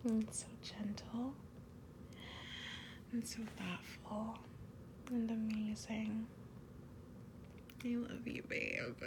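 soft intimate whisper close to the ear; ASMR whisper. Acted. Girlfriend confessing love to her partner, whispered. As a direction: breathe each word like a secret to a lover. Thanks partner for wiping tears.

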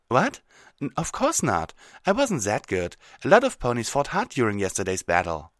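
startled, modest brush-off; flustered Twilight. Acted. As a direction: deny it, caught off guard.

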